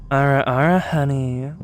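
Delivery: low teasing ara ara. Shown as a demonstration. Streamer trying a female voice for chat. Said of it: "the best I can do is".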